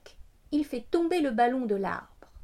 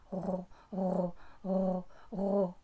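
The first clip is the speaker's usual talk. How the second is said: purring cat person; 6 semitones lower, 7 dB quieter, slower than usual. A narrator's reading. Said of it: "ronronne le chat".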